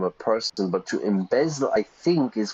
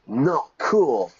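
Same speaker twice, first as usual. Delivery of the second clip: emphatic correction; 5 semitones higher, 4 dB louder than usual. Real, not acted.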